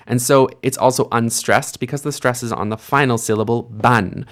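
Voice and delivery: ordinary talk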